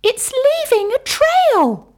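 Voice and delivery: high, loud pointing cry; child calling out in class; excited child. Acted. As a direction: call out to everyone to look. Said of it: "say the children".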